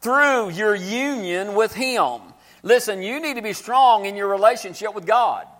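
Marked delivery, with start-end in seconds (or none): 0.0-2.2 slow, weighty reading of the verse; slow scripture reading. Acted. Preacher reading the verse slowly to the church. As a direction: lay each word down slowly.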